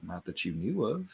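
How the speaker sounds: low, soft and ominous; ominous hush. Acted. Ominous hushed reply.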